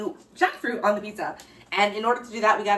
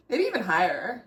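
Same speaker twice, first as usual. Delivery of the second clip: usual speaking voice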